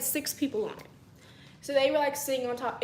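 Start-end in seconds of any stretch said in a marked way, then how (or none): none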